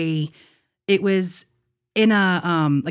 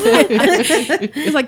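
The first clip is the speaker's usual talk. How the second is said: laughing; 9 semitones higher, 7 dB louder than usual. Real, not acted. Cracks up at the punchline.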